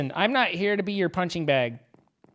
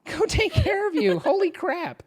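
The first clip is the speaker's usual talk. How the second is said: high laughing exclamations; laughing, loud and high; 12 semitones higher, 5 dB louder than usual. Real, not acted. Laughing, urging friend to warm up.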